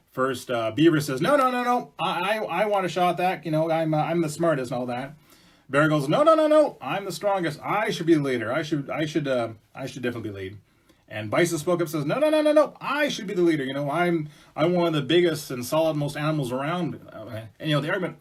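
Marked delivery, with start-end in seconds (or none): 1.2-5.1 much higher, louder, eager protest; high excited beaver voice. Acted. 6.1-10.6 very high, loud boasting bear; high bragging bear voice. Acted. 12.0-17.0 high, loud, bragging protest; loud bison voice. Acted.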